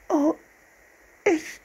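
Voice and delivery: put-on high, halting girl's stammer. A narrator's reading. Sister startled at the window, high faltering stammer. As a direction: break off between words, pitched very high. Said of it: "stammelte Anne".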